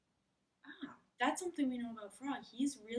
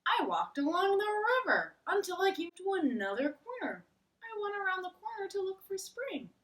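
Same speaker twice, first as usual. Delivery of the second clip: higher and louder, eager Frog on a quest; Frog's voice; 10 semitones higher, 4 dB louder than usual. Acted. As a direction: Frog telling Toad about hunting spring, lively and high. Frog continues his story.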